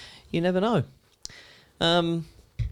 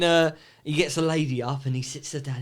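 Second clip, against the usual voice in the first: normal talking voice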